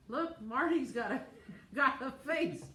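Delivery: high, loud giggling through words; laughing. Real, not acted. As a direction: laugh while pointing it out. Laughing at husband's face.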